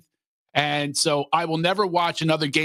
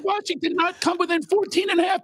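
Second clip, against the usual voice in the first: outraged shout. Real, not acted. Outraged about lost bet.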